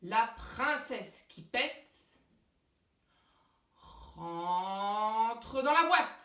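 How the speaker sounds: slow, building stage magician incantation; kids party magician. Acted. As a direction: draw it out like a magic spell.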